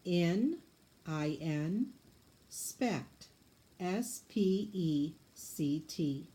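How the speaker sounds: syllable, then its letters spelt out slowly; teacher scooping the word into syllables. Shown as a demonstration. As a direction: break "Inspect" into "In" and "spect" and spell each part letter by letter. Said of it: "Go ahead and scoop it".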